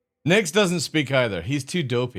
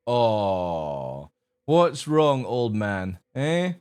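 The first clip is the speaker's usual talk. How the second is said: normal talking voice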